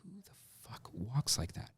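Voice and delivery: a director grumbling at the monitor, quiet disbelief; retold Fincher mutter. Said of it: "he just kept going".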